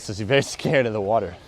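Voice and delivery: usual speaking voice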